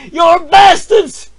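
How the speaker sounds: slow, screaming high and loud; furious shout. Acted. Peasant screams at the lord's soldiers, furious shout. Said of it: "I shouted".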